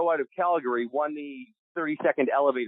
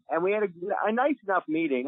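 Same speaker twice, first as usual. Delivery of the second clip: ordinary talk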